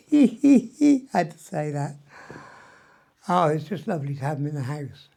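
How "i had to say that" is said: laughing through the words, slow; said through real laughter, slow and louder. Real, not acted. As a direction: giggle out a cheeky confession. Laughs after teasing Ollie.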